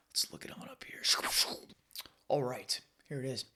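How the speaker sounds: breathy whisper while searching; whispering to himself. Real, not acted. Vlogger whispers to himself while pulling up the article. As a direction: murmur it under your breath.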